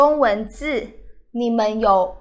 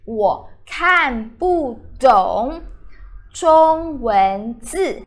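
slow; much slower than usual. Shown as a demonstration. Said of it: "teacher models phrase slowly".

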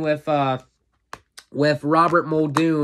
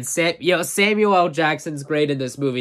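ordinary talk, nothing marked

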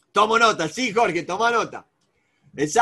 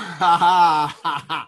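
hearty laugh at a question; amused open laugh; 4 semitones lower, 3 dB louder than usual. Real, not acted. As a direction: burst out laughing.

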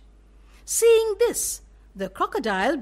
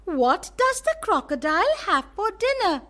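little elephant voice; 7 semitones higher than usual. Acted. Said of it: "One morning he asked".

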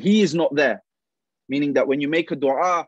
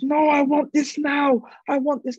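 put-on whining toddler; 13 semitones higher, 4 dB louder than usual. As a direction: wail it like a small child who won't take no. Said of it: "He starts crying".

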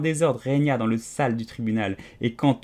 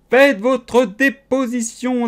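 slow, loud and high command; king ordering the cook to testify; loud royal order; 10 semitones higher, 6 dB louder, slower than usual. A narrator's reading. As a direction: boom it out like a pompous king.

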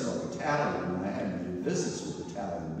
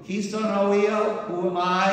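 loud, high teasing call across the cloister; mock scream; 11 semitones higher, 8 dB louder than usual. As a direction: yell it out to mock him in front of the group. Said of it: "he'd scream over me".

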